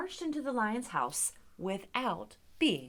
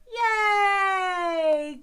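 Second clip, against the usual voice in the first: long, loud, high yay; big cheer; 9 semitones higher, 13 dB louder than usual. Real, not acted. Teacher celebrates the singing with friends, big cheer. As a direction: cheer it out and let it slide down.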